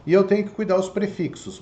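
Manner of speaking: ordinary talk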